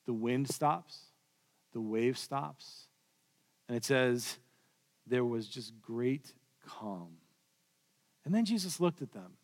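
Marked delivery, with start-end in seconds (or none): 0.1-7.2 hushed calm. A narrator's reading. Storm stilled, great calm.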